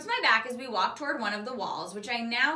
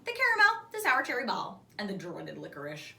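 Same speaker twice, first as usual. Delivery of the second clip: usual speaking voice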